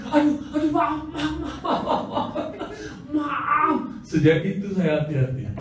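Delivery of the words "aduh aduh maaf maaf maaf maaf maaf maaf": high, laughing sorry-sorry; mock-sheepish laughing apology. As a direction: giggle out a string of apologies.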